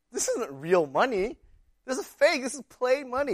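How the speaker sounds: high, loud indignant protest; outraged kid. Retold or imitated. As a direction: cry foul like a cheated kid. Cousin discovers fake bill.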